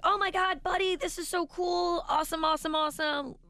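put-on high, excited little boy gushing; kid excitement. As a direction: gush like a thrilled young son. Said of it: "He's like".

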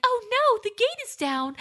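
high mock-panicked damsel cry; damsel in distress. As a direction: squeal it like a helpless princess. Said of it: "damsel in distress role".

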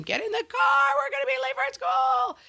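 high-pitched mom shout; acted yelling. Mom yelling at kids late for school, high shouting. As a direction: yell it up high at dawdling kids. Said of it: "me yelling at them".

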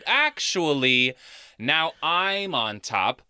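slow, drawn-out mock assertiveness; cocky takeover. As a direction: spell it out slowly, savouring control. Imagines heroine taking control.

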